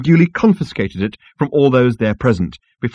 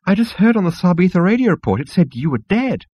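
high, agitated insect voice; squeal; 9 semitones higher than usual. Acted. Agitated insect tells Zaphod of a radio report, squealing. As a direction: squeal out the news. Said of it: "it squealed".

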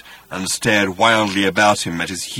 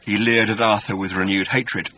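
ordinary delivery

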